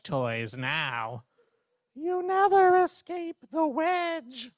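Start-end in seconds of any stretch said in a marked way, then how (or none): 1.9-4.5 high, slow, spooky whisper-wail; a ghost haunting the dark; eerie warning. Acted. A wedge toy warns Bear in an eerie, slow high voice.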